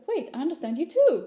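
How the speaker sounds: retold surprise. Husband to wife on finding they share language, surprised.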